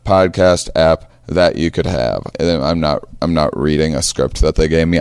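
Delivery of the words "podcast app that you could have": scripted ad read, high and bright at the start; sales pitch voice. Pretend. Host plugs a podcast app to listeners, sales pitch voice. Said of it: "make it sound like I was reading a script in my sales pitch voice".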